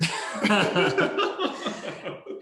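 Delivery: high burst of laughter; real, high-pitched laugh. Real, not acted. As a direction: laugh out loud, high and long. Reacting to the unused gift card.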